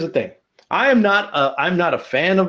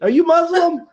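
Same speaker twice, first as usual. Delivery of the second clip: higher, pushy blustering politician voice; bad Donald Trump impression; 7 semitones higher than usual. Mocking Trump's wall promises to his friends, high blustering voice. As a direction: bluster like a braggy candidate. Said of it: "my very bad Donald Trump impression".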